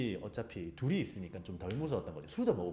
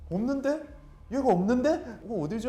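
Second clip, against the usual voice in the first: loud high baffled question; puzzled surprise; 13 semitones higher, 8 dB louder than usual. Retold or imitated. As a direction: sound genuinely stunned it's gone. Friend searches for ghost. (귀신이 사라져 놀란 친구의 높은 의문)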